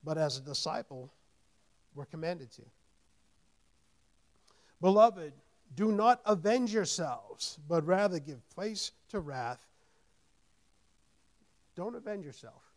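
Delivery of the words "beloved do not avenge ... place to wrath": loud emphatic reading, slowing at the end; preacher reading Romans to the congregation; scripture proclamation. Acted. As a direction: lay out each line of the verse firmly. Reads scripture slowly, loudly.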